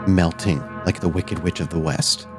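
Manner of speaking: measured, suspenseful narration with long pauses; game master's scene setting. A narrator's reading. Game master describes a spirit melting away, hushed suspenseful narration. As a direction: let each image land before the next.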